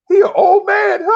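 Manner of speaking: high, loud squealed taunt; hammed-up teasing wail; mock jeering. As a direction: imitate young hecklers in a shrieked jeer. Imitates young trolls jeering.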